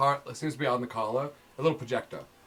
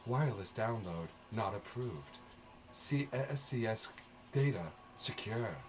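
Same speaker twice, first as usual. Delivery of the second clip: computer warning voice; 3 semitones lower, 9 dB quieter, slower than usual. Acted.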